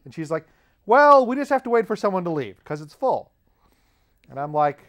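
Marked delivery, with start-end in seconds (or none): 0.9-3.3 high, loud, matter-of-fact voice; Canadian lady explaining. Retold or imitated. Lady explains the wait to traveler, explaining. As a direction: imitate her explaining, pitched well up.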